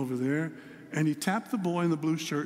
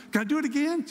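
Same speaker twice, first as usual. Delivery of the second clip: put-on much higher, louder begging child voice; eager little grandson; 9 semitones higher, 4 dB louder, faster than usual. Grandson begging his pop for another slide, high eager child voice. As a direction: beg like a thrilled three-year-old.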